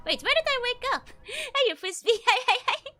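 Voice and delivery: loud, high excited calling out; excited shout. Real, not acted. Player shouting excitedly at the new spot. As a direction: call out, thrilled and laughing.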